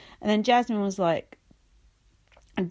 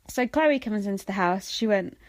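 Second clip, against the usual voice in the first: usual speaking voice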